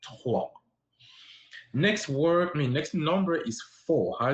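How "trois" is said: "trois" with a French guttural R; throaty French R. Shown as a demonstration. Teaching students three in French, throaty R. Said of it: "Use your throat".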